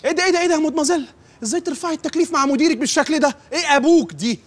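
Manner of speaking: high, fast, outraged rebuke; indignant outburst. Acted. Boss scolding a late employee for calling him uncle, outraged. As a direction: scold her sharply and fast, voice jumping up.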